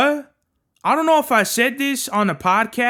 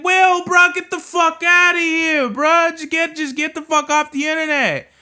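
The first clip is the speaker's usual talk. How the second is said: exasperated shouting; 7 semitones higher than usual. Pretend. Fed-up commentator telling Will to log off, exasperated shouting. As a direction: yell it like you've had enough of him.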